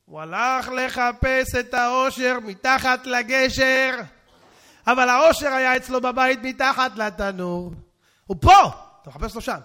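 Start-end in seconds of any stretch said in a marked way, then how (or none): 0.0-7.7 loud, drawn-out moral of the tale; emphatic preacher's declaration; preach. Real, not acted. Preacher drives home the moral. 8.3-8.7 high, loud burst on the point; preacher's sudden yell; emphatic shout. Real, not acted. Preacher hammers the moral.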